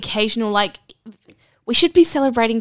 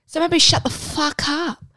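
mock indignant; 8 semitones higher than usual. Ranting at Halloween haters.

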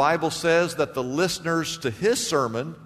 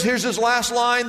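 ordinary talk, nothing marked

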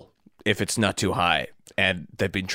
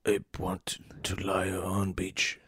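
whispered ape talk; 9 dB quieter, slower than usual. Acted. Caesar murmurs his wish.

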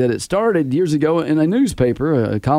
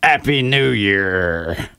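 lightly voiced pirate accent; 5 semitones lower, much slower than usual. Shown as a demonstration. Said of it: "it could be like".

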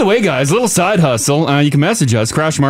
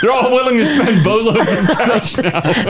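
slow, high, laughing aside; 6 semitones higher than usual. Real, not acted. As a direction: laugh through the line. Joking about companies.

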